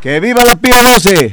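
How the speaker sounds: very high, loud rallying yell. Real, not acted. Student leader salutes the radio, forceful shout. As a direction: yell a viva for the station. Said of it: "un grito con más fuerza".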